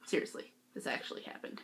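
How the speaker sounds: fast, breathy whisper; whispered aside. Real, not acted. Vlogger confiding to viewers, fast whispered aside. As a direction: lean in and whisper it.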